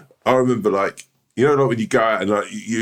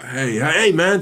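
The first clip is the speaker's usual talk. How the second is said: hearty greeting; 8 semitones higher, slower than usual. Retold or imitated. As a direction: greet him like an old mate. Confident guy greeting.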